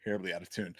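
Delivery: normal talking voice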